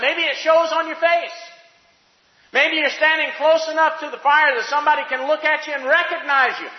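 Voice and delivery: high, loud, driving sermon voice; preaching at full force. Acted. Preacher stirring his congregation, loud and high. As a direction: build the crowd up with a preacher's fire.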